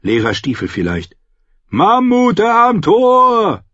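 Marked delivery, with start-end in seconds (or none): 1.7-3.6 high, long-drawn warning yell; shouted alarm. A narrator's reading. Lookout warns of mammoths at gate, shouted alarm. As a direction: yell it so the whole wall hears.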